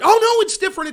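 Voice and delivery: high, loud sarcastic sing-song; mock their excuse, loud and high; hammed-up mocking of a hypocrite. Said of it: "they could tell you outward".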